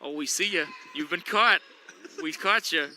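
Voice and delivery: ordinary talk, nothing marked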